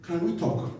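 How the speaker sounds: soft, low request for a private word; hushed, tentative aside; discreet approach. Acted. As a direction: approach the pastor quietly and respectfully. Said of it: "you can call me".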